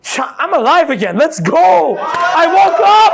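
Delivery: building to a high, loud, slow hype; pumped up. Retold or imitated. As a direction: fire yourself up for the day. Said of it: "you go".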